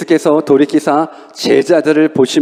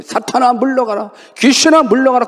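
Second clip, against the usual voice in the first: rebuke; 6 semitones higher than usual. Retold or imitated. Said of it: "꾸짖는 거예요".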